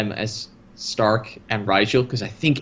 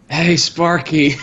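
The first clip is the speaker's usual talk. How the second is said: drawn-out, louder, higher greeting; playful calling out; 4 semitones higher, 5 dB louder, slower than usual. Acted. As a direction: hail a buddy in a hammed-up voice. Greeting acted out from the show.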